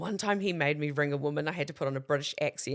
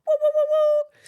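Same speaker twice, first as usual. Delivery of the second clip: loud, very high woo woo cheer; excited woo; 8 dB louder than usual. Retold or imitated. Celebrating her first time on air, loud high whoops. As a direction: whoop with pure glee.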